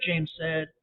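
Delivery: ordinary delivery, nothing marked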